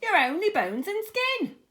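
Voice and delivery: high, loud shrieked taunt; scornful high-pitched shriek; shrieking insult. A narrator's reading. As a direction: screech the insult at her sister. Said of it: "Aunt Sponge cried out".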